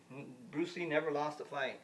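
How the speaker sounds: ordinary talk, nothing marked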